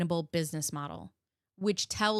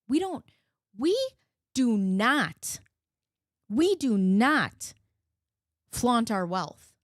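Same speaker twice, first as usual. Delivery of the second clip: slow, emphatic mock declaration; mock their claim word by word, slowly and firmly; sarcastic mimicry; 3 semitones higher, 4 dB louder, slower than usual. Commentator mocking the couple's claim about wealth, sarcastic.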